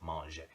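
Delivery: "manger" as "mon-zhay", final r silent; silent French ER. Shown as a demonstration. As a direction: end "manger" on an "ay" sound. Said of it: "don't even pronounce the last two".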